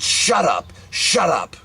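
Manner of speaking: high-pitched mock maternal yell. Panicked mother silencing her child, imitated yelling. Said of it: "She just kept yelling and screaming".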